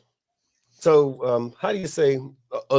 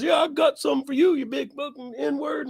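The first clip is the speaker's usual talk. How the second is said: threatening yell; 13 semitones higher than usual. Retold or imitated. Stranger showing his gun to the friend, high shouted threat. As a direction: shout it while flashing a gun. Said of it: "he goes".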